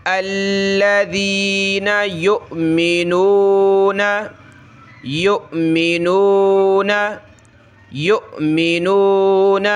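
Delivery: یؤمنون with a sharp glottal catch after یُ; slow, deliberate stop on the hamza in یؤمنون; teacher drills the hamza stop; hamza jolt. Shown as a demonstration. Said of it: "حمزہ ساکنہ کو جھٹکا دے".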